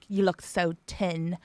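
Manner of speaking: "tin" for thin, th said as a hard T; slow retold verdict, "tin" with a plain T; grandmother's Irish th. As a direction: quote an Irish grandmother, slowly, saying "tin" with a T. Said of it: "she was like".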